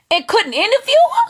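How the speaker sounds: high, quick, rising disbelief; incredulous. Real, not acted. Viewer marvels that the interviewer failed, incredulous. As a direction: rush it upward like an astonished question.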